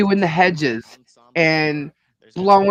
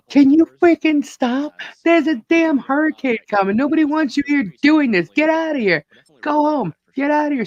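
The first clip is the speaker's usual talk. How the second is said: retold yelling; 9 semitones higher than usual. Neighbour yelling at the hedge-cutting man to go home, high retold yell. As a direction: shout him off the property, pitch way up. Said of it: "she yells at him".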